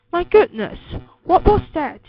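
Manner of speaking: high, loud exclamation of shock; startled cry. A narrator's reading. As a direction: cry out like an alarmed mother. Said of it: "she cried".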